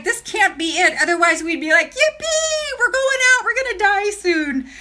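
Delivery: high, hammed-up mock cheer at yippee; mock cheerful voice. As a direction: squeal yippee like a giddy fool. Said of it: "I was just like".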